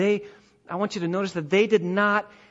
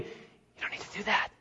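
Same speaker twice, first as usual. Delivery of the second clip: hushed aside; 10 dB quieter than usual. Real, not acted. Preacher drops voice for effect.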